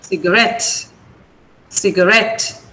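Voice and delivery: "Cigarette" with RET stressed, low then rising. Shown as a demonstration. Teacher modelling "Cigarette" for students, RET stressed. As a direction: keep "ciga" light and land on RET in "Cigarette". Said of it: "The stress is on ret".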